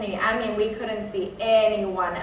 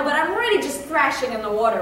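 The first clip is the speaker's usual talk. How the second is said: ordinary delivery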